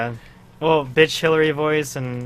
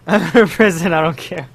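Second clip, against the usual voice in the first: mock prisoner; 4 semitones higher, 7 dB louder than usual. Hammed-up mock of prisoner character.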